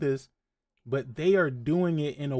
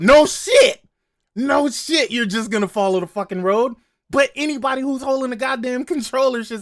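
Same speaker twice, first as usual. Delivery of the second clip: loud exasperated shout; 8 semitones higher, 8 dB louder than usual. Real, not acted. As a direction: yell it in disbelief. Ranting at the obvious advice.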